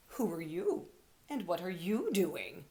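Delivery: lightly voiced gruff old rabbit. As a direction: challenge the stranger gruffly. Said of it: "large rabbit was glaring at him".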